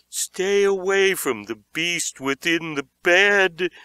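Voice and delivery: slow, ominous scared warning; fearful warning. A narrator's reading. Beaver warns Josh of the beast, slow and fearful. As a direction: warn him off the bed in dread. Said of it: "he cried".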